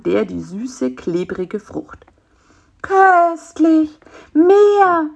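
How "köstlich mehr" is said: high, loud, drawn-out groan of pleasure; ecstatic moaning. Acted. Fly devours the sweet fig, moaning with pleasure. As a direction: moan it greedily. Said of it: "stöhnte Bisi".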